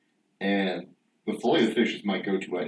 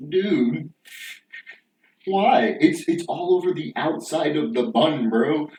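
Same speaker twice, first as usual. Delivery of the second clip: higher, louder exasperated complaint; mock outrage; 5 semitones higher, 4 dB louder, slower than usual. Speaker complaining to himself about the sauce, higher and louder. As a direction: vent disbelief at the sandwich.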